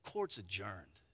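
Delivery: low, very soft, matter-of-fact; calm aside. Retold or imitated. Othniel telling Israel it's over, very soft and low. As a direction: toss it off quietly over the shoulder. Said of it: "looking back over his shoulder and saying".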